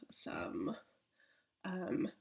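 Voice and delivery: breathy conspiratorial whisper, barely voiced. Real, not acted. Teasing viewers about her racy clip, in a giggly whisper. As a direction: lean in and whisper it like a naughty secret.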